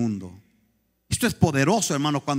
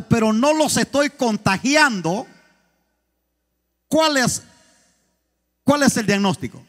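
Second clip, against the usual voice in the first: loud, raised preaching, pressing the point; preaching at full force; 6 semitones higher, 3 dB louder than usual. Acted. Pastor challenges the congregation about not contagiating others, preaching hard. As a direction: drive it home like a fired-up pastor.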